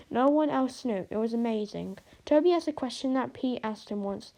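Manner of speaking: usual speaking voice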